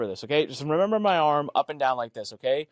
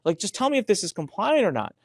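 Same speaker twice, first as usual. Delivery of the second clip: higher, mock-exasperated outburst; exasperated plea; 5 semitones higher than usual. Telling Greg off for asking for context, exasperated and high. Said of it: "I'm like".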